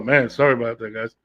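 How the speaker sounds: usual speaking voice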